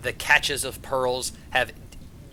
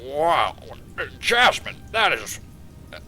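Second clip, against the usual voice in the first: halting, impressed, proud royal voice; doting father king. Acted. King thanking Jasmine and praising his daughter, proud. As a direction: praise them as a pleased, slightly stunned ruler.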